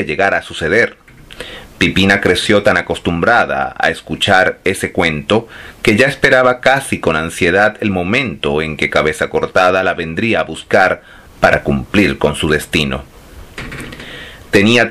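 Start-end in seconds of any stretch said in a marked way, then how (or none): none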